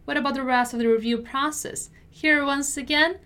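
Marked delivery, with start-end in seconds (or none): 0.1-1.9 brisk, connected natural-speed read; fast model sentence for learners; quicker repeat. Shown as a demonstration. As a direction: run the words together at conversational speed. Teacher repeats at quicker pace.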